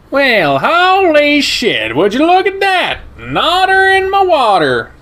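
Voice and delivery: high, loud, brash stranger voice; German shepherd greeting the otter, high and loud; brash shepherd guy. Acted. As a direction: crank the pitch way up and bark it out with delight.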